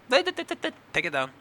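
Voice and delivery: stuck on did, jumping very high and loud; stammering squeak. Real, not acted. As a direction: sputter in disbelief. Mock stammer of outrage.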